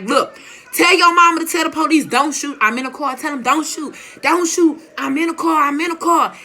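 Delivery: high, loud, urgent begging; desperate to not get shot; frantic plea. Retold or imitated. Begging on phone, guns drawn.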